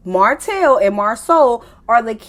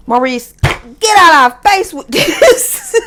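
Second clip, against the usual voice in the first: loud, high, slow get out our face; half-laughing exasperated yell; exasperated shout; 7 semitones higher, 7 dB louder than usual. Real, not acted. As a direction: drag it out, loud and high in disbelief. Exasperated at Maurice's story.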